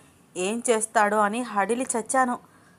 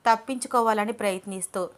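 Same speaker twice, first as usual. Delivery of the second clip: ordinary delivery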